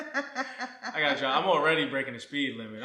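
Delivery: husband laughing at being rushed; laughing protest. Real, not acted. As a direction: laugh through the excuse. Laughing protest in retold rush.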